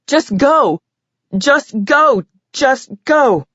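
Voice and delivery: high, urgent repeated pleas; retold scream. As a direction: cry it out again and again. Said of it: "I screamed at him".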